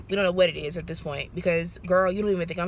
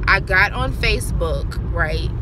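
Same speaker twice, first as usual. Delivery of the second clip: ordinary talk, nothing marked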